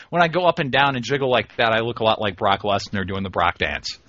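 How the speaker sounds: ordinary delivery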